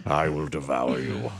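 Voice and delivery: very low, slow mock-monster threat; deep menacing voice. [name] threatening Chris over her secret, deep pretend menace. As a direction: drop to the bottom and draw it out. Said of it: "a deep James Earl Jones voice".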